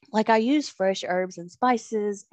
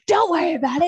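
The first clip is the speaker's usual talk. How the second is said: high, loud retold outburst; angry brush-off; 10 semitones higher, 8 dB louder, faster than usual. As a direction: snap it back in mock fury. Acted quarrel with husband.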